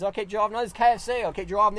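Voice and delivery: ordinary delivery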